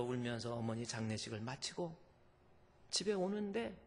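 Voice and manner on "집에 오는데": normal talking voice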